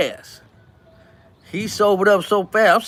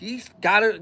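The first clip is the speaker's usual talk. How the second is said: ordinary talk, nothing marked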